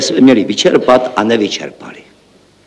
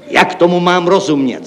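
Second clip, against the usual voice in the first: raised-pitch indignant question; exclaiming; 7 semitones higher than usual. Acted. Chief accountant questions the referent, exclaiming. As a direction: call it out as a demand. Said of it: "zvolal hlavní účetní".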